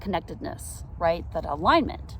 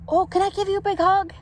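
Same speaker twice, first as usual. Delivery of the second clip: much higher, fast, sweet lady voice; a gushing stranger offering a hug; kindly stranger lady; 13 semitones higher, 4 dB louder, faster than usual. Retold or imitated. Lady in the park offering a hug, high sweet voice. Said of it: "she goes".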